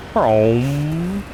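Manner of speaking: long rising vroom made by mouth; imitated engine vroom. Player driving off in the game, drawn-out playful vroom. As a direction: hum it like an engine revving up.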